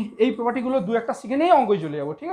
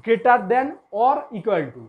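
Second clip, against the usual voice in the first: "greater than or equal to" very slowly, word by word; very slow teacher's dictation of "greater than or equal to"; slow, careful demo; much slower than usual. Shown as a demonstration. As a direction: spell it out slowly for learners, pausing between words. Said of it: "ধীরে ধীরে বলছি".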